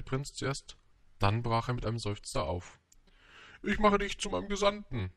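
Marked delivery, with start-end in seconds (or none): 3.6-5.1 high-pitched royal call; hasty calling after. A narrator's reading. King hurriedly calls after the departing prince, high mild call. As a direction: call after someone leaving, mildly raised. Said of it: "beeilte sich der König, ihm nachzurufen".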